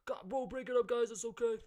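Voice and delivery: hammed-up friend soothing two guys about to brawl; mock peacemaker voice. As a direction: imitate a buddy stepping in between two fighters. Friend breaks up the fight.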